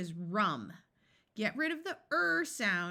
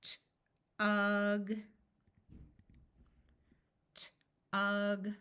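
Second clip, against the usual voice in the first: said slowly; slower than usual. Shown as a demonstration. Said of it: "I'm going to say a word slowly".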